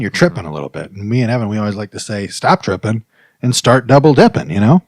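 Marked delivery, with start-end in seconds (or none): none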